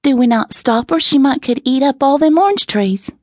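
put-on high, animated little country girl; urgent Southern child, pitched well up; lively. A narrator's reading. Essie urging her pa to stop the cow, lively. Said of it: "went on in a more lively tone".

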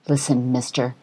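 a quietly stern warning. Acted. TV host sternly warns the uncooperative homeless man. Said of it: "she said sternly".

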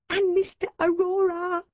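high surprised exclamation; sudden excited recognition. Acted. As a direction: blurt the discovery.

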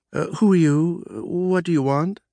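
mild absent-minded mumble; distracted mumble. Boss distractedly asks the visitor, lightly mumbling. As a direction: answer without looking up. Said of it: "I mumbled distractedly".

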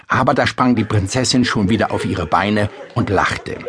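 plain reading voice

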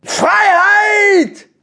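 very high, loud cry; triumphant shout. Acted. Harry the badger escapes the prison, triumphant shout. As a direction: yell it out long and high. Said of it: "rief Harry Dax".

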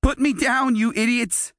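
put-on boy carried off, yelling at captors; angry yell. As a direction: shout at them to let go. Captive boy yelling at bullies.

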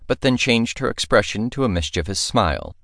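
ordinary delivery, nothing marked

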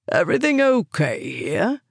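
lightly voiced man's inquiry, pitch swinging up; a hint of a male neighbor checking in; deep male voice. A narrator's reading. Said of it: "a deep male voice inquired".

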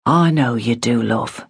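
a father gently soothing; softened father. A narrator's reading. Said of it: "Her father's tone softened".

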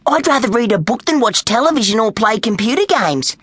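high, put-on child voice; feigned bookworm. A narrator's reading. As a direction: play the eager little reader. Schemer feigns love of books.

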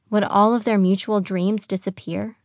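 ordinary delivery

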